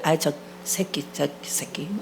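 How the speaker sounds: low, hushed swearing, fading to a whisper; mock cursing under the breath; muttered cursing. Pastor mimicking a driver cut off in traffic, muttered curses.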